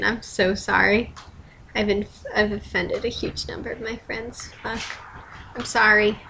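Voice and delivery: usual speaking voice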